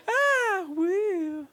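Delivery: very high, hammed-up cackle; mock maniacal laughter. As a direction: cackle like a maniac, ending in a whoop. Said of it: "she just starts laughing like a maniac".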